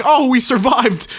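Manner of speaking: high, loud, drawn-out mock relief; mock relieved survivor. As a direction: play a survivor celebrating too soon. Acts out relieved storm survivors.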